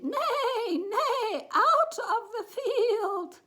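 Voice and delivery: high, loud whinnying shout; neighing horse. A narrator's reading. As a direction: neigh a drawn-out order to get out. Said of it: "when he chased them and said".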